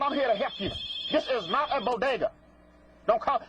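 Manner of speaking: ordinary talk, nothing marked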